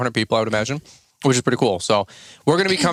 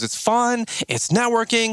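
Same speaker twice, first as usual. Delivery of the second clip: light imitation of an Alabama accent, pitched higher; Savannah's Alabama accent; 10 semitones higher, slower than usual. Quoting Savannah from a podcast on selling cruises, mild Alabama accent. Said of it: "Alabama accent".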